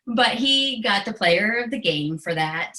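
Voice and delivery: normal talking voice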